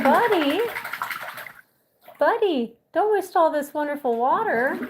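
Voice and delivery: high, sing-song gentle scold to the bird; pet talk. Real, not acted.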